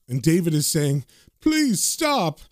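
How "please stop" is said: high, pleading cry; whimpering plea pitched way up; panicked begging. Acted. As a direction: beg to be freed in acted terror. Frightened man begs to be released.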